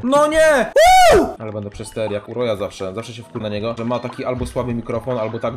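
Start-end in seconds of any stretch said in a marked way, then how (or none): 0.0-0.7 głośne, wysokie „no nie”; wściekły protest. Real, not acted. Porażka w grze. 1.1-1.3 bardzo głośne, piskliwe „uuu”; przeciągły wrzask. Real, not acted. Gracz wrzeszczy przy grze.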